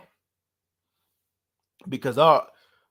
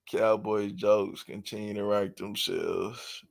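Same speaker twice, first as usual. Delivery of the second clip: ordinary talk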